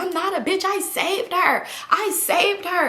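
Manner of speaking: high-pitched imitation of a wounded friend; whiny protest. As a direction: plead your case in a high whine. Acted drunk friend protesting insult.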